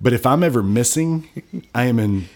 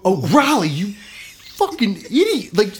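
self-scolding; 11 semitones higher than usual. Retold or imitated. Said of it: "I wanted to go".

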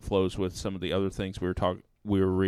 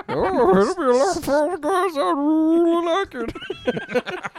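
very high, loud cartoon squeal; over-the-top mock-seductive falsetto; Meatwad; 9 dB louder, slower than usual. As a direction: squeal it out, stretching the last words. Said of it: "sexy voice is Meatwad".

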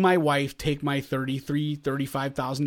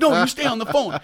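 a touch of a raised, higher voice; insistent; 7 semitones higher, 4 dB louder than usual. Retold or imitated. Son telling his mom to stay on the phone, mildly raised. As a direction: tell her firmly to stay on. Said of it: "I'm like".

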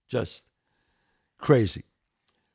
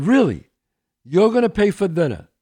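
loud, high incredulous question; retold disbelief; 6 semitones higher, 10 dB louder than usual. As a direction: mimic being floored by the offer. Retold disbelief at friend's offer.

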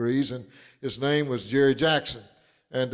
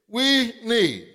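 preaching; 7 semitones higher than usual. Acted. Pastor preaching to his congregation, high and fervent. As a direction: preach it with rising conviction. Preaching rises to full force.

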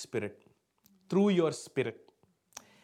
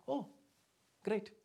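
deadpan mock response; acted-out indifference; stone-faced; 6 semitones higher, 5 dB quieter than usual. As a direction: react like a stone to someone's heartbreak. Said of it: "I'm like a stone face".

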